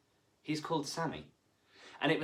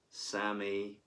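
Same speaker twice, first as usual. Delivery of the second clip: ordinary delivery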